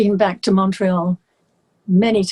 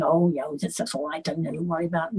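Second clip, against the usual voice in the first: softer, lower brushing-off voice; dismissive reassurance; 4 semitones lower, 9 dB quieter, faster than usual. Retold or imitated. Friends brushing off her distress, softer and lower. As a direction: mumble it like a shrug. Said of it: "Everyone was saying".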